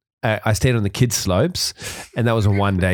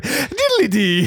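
high, slow mock Irish sing-song; Irish lilt; 13 semitones higher, much slower than usual. Teasing a half-Irish friend skiing down, mock Irish lilt. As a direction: drag it out like a stage Irishman. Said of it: "So you go down the mountain like".

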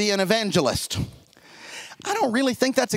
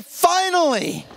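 relieved finally; 8 semitones higher, slower than usual. Pretend. Oppressed faithful hear judgment.

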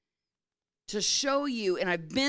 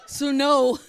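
loud, higher-pitched acted refusal; firm husband refusing; 4 semitones higher, 9 dB louder than usual. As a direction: throw the no back at her loudly. Husband firmly refusing his laboring wife.

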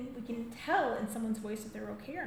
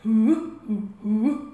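auntie's hooting call; 2 semitones lower, 13 dB louder, much slower than usual. Retold or imitated. Auntie Georgie calling back, loud hoots. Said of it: "There's my auntie Georgie".

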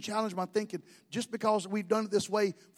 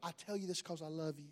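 soft, sweet mock-loving voice, much quieter; a saccharine church member faking tender concern; sugary church voice; 4 semitones lower, 13 dB quieter than usual. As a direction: coo it like a syrupy church friend. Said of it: "how many's been around Pentecost".

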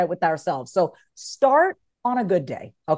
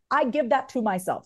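ordinary talk, nothing marked

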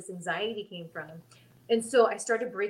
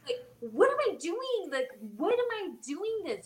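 mock-anguished self-questioning; 9 semitones higher than usual. Nervous wrestler questioning herself, mock anguish. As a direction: question yourself in a hammed-up high wail.

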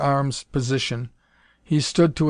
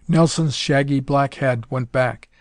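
ordinary delivery, nothing marked

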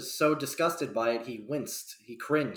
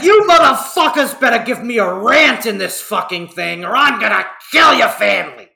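hammed-up high, loud threat; over-the-top shouted rant; mock furious demand; 7 semitones higher, 11 dB louder than usual. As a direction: yell it like a tyrant pounding the table. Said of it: "He demanded with his fist on the table".

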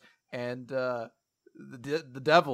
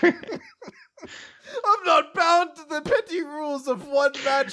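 high, hammed-up devil voice; pompous villain disdain for rules; Satan's mock boast; 12 semitones higher, 4 dB louder than usual. Host voicing Satan scorning petty rules, grandiose villain.

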